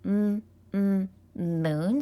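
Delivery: "m, m, moon" with humming lips-closed m sounds; sounding out M. Shown as a demonstration. Teacher sounding out a quiz word, slow humming. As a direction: hum "m" twice, then say "moon" slowly. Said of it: "Does m".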